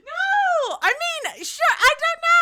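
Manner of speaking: high squealing protest; real giggly shriek; shrieking laugh. Real, not acted. Laughing at her own mistake.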